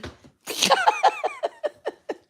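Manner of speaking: very high shrieking laugh. Real, not acted. Laughing at her electric lipstick joke, high shrieking laugh.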